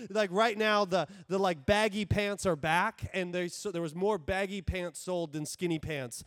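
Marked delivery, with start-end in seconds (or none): none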